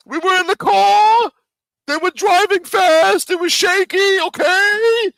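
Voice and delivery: hammed-up high voice of a man defending his bad shooting; mock whiny excuse. Mocks Dom's excuse for missing.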